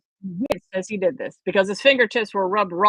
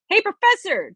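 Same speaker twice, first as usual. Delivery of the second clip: higher, urgent call to the professor; yelling; 10 semitones higher, 3 dB louder than usual. Acted. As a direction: shout him over. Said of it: "Johnny yelled".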